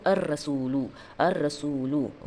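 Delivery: slow "ar-rasulu" with a long trilled r and no l; merged lam. Shown as a demonstration. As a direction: draw out the doubled r in "ar-rasulu". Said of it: "direct, अ, उसके main letter पे मर्ज".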